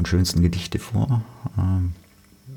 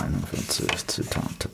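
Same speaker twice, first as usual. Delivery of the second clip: whispered; 11 semitones lower, 8 dB quieter than usual. Real, not acted. Muttering the page title to himself.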